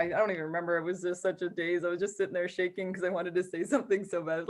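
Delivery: usual speaking voice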